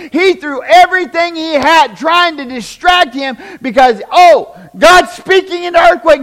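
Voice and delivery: shouted, high sermon delivery; preaching at full force. Real, not acted. Preacher shouting to the congregation, fiery. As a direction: preach it out loud and hard to the church.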